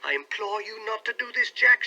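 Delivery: high, loud imploring cry; pleading wife crying out. A narrator's reading. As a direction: cry out to stop him. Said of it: "she cried".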